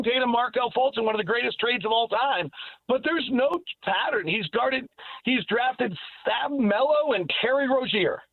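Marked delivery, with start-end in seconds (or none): none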